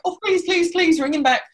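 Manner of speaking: high, loud begging; pleading. Retold or imitated. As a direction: plead like a desperate teen fan. Begging again.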